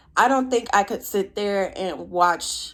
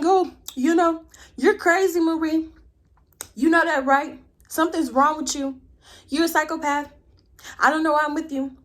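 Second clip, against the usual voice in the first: high-pitched mock berating; imitated angry boyfriend; 8 semitones higher, 4 dB louder than usual. Boyfriend berating Marie over macaroni and cheese, imitated. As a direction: hurl mock accusations in a raised pitch. Retelling boyfriend's insults during argument.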